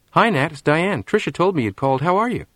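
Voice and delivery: friendly girl greeting a shy boy, voiced lightly; lightly voiced teen girl. Diane voiced by reader.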